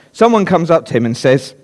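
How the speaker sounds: normal talking voice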